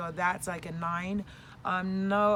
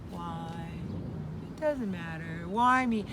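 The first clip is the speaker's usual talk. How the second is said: Eeyore; 3 semitones lower than usual. Acted. Vlogger imitating her own gloomy days, glum Eeyore. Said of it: "like, I'm an E".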